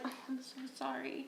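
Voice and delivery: very soft, breathy mock condolence; fake sympathy. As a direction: pretend to be sorry while scheming. Fake sympathy to the dumped guy.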